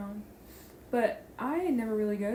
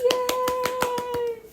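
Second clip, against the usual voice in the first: excited cheer; 14 semitones higher, 4 dB louder than usual. Real, not acted. Cheering the intro.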